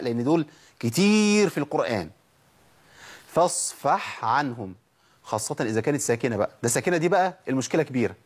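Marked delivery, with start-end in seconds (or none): 3.3-4.7 فاصفح with a clear breathy ح before عنهم; clear sakin ha. Shown as a demonstration. Teacher reciting the Quran example slowly. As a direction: hold the ح of فاصفح apart from the ع. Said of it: "مثال للعين والحاء".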